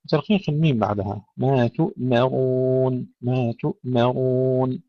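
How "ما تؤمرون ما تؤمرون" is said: تؤمرون with a clean stop on ؤ, then thin meem; slow recital, no bounce after the hamza; still hamza. Shown as a demonstration. Teacher modelling the word, hamza stopped cleanly. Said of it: "تسكين الهمزة بدون قلقلة".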